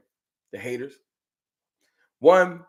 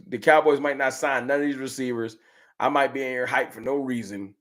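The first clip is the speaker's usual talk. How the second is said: normal talking voice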